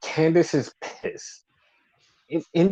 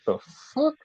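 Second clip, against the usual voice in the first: baffled; 11 semitones higher, 4 dB quieter than usual. Retold or imitated. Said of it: "was like".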